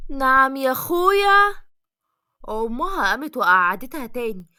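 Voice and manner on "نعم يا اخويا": slow, high, louder indignant "what?!"; indignant retort. Acted. Farah reacting to the marriage proposal with slow, pitched-up indignation. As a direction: drag it out in shocked indignation. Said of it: "واتصدم الكل من رد فعل فرح".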